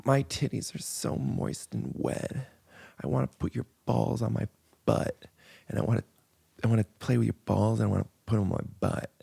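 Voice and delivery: phone sex woman. Pretend. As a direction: whisper it like a mock phone-sex line. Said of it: "Oh, am I the woman?".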